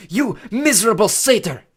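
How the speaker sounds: loud, high furious snarl; enraged snarl. A narrator's reading. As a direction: roar with rage at an attacker. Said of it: "she snarled".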